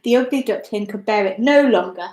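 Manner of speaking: usual speaking voice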